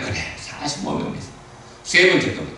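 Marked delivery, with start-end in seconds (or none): 0.0-1.3 low, hushed answer; quiet God's reply. Acted. Preacher voicing God's hushed reply to Abraham. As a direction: answer softly, almost breathed.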